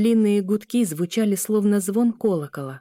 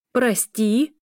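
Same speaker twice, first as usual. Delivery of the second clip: higher, rising incredulous question; mild narrated outcry, pitched up at the end; indignant cry; 4 semitones higher, 4 dB louder than usual. A narrator's reading. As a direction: throw the word back as an outraged question. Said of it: "закричала женщина ещё громче".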